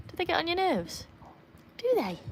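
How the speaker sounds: high sing-song question to the dog. Real, not acted. Owner teases her dog about the other dogs. Teasing baby talk to dog.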